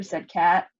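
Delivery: ordinary delivery, nothing marked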